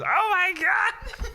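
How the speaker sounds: high, drawn-out mock shriek; mother's shocked cry. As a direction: imitate a horrified mom, stretched out high and loud. Retells mother walking in on him.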